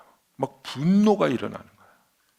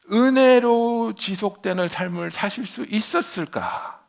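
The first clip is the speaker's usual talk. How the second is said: normal talking voice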